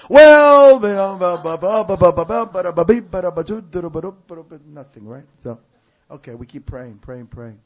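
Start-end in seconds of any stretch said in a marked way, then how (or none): 0.1-4.6 high, loud start tumbling into nonsense syllables; mock blah-blah fading away; babbling evasion. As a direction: mimic someone blathering excuses. Mimicking her evasive babbling reply.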